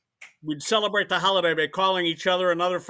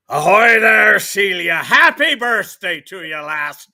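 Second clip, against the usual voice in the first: loud hearty pirate, slow; 10 dB louder, slower than usual. Pretend. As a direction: boom it out like an old sea captain. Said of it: "sir, in a pirate voice".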